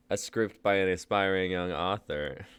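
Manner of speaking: usual speaking voice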